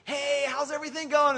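loud, high-pitched enthusiastic hello; cheery greeting. Acted. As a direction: greet them with over-the-top cheer.

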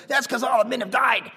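high shouted imitation of dad; blunt shouted retort. Dad answering his son's remark, blunt shouted retort.

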